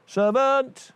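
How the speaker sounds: high, loud royal call for the servant; king calling out. Acted. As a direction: summon the servant from across the hall. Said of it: "He called for the servant".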